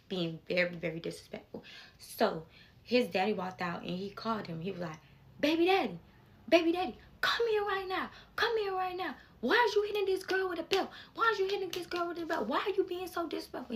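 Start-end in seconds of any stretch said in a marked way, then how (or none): 5.4-13.8 angry father yelling. Retold or imitated. Dad yelling at his son over the belt, angry and high. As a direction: scold the grown son like a furious dad.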